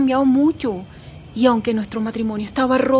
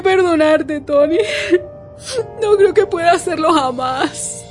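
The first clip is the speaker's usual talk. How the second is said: gasping soap opera drama; 6 semitones higher, 3 dB louder than usual. Acted. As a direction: start restrained, then break into a wailing accusation. Widow's tearful reproach at coffin.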